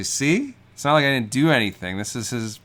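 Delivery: insistent self-justification. Acted. Barber showing customers the hair, insistent and defensive. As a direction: plead your case to doubting onlookers.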